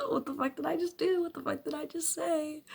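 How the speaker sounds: high, bewildered exclamation at herself; baffled outburst. Acted. Vlogger opens the video stunned by what she just said, high baffled outburst. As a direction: blurt it out, stunned by your own words.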